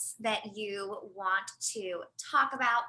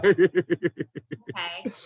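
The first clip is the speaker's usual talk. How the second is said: reassuring; 7 dB louder, much slower than usual. Real, not acted. Comforting the crying guest.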